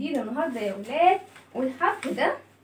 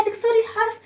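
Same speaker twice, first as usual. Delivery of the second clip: alarmed question; 8 semitones higher, much faster than usual. Acted. Letter reacts to kasra's plan.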